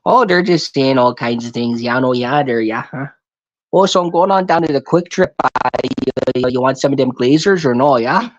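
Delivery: put-on a lightly done local accent, fast and run together; fast local drawl. Mocking how locals talk, a hint of the local accent. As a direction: rattle it off in the local accent people talk around here. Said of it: "talking around like this".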